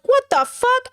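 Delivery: sudden high shocked outburst. Retold or imitated. Streamer reliving her shock at her own clip. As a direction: blurt it in disbelief at yourself.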